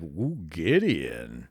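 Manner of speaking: low, slow, drawn-out swoon; swooning fan. Retold or imitated. Fan swooning over Gideon's name, slow and low. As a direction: sigh the name like a starstruck fan.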